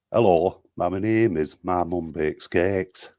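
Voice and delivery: slow northern British accent; demonstrating a regional accent, slowly; put-on regional accent. Shown as a demonstration. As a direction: draw the name out in a broad northern British accent. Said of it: "give one in as many accents as you want".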